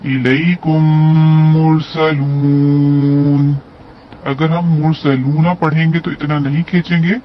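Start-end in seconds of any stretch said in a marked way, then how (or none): 0.1-3.4 मुरसलून with the long ऊ stretched to four counts at the pause; four-count madd. Shown as a demonstration. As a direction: draw out मुरसलून slowly, holding the ऊ. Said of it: "हम चार हरकत खींच रहे हैं".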